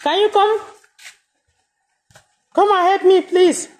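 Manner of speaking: high-pitched call to someone far away. Real, not acted. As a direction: raise the voice high to call for help. Calling someone for help.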